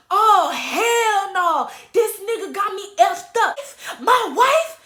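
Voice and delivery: loud, high, drawn-out indignation; hammed-up fatherly outrage; outraged husband. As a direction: imitate a dad bellowing in disbelief. Retold outraged father overheard.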